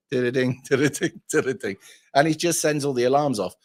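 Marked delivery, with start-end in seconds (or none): 0.1-1.8 did-a-ding chime with a laugh, falling at the end; alarm chime. Retold or imitated. Trucker mimics the alarm going off while driving, laughing chime. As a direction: chime it out again, chuckling, letting it fall. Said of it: "and get".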